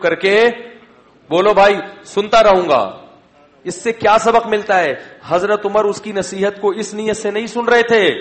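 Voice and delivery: fervent preaching. Acted. Preacher urging listeners to please God, loud fervent sermon. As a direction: drive the lesson home to the congregation.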